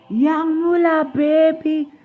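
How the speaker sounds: loud, high, stretched-out hype call. Pretend. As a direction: shout it like a rapper's ad-lib. Hype shout-out in put-on voice.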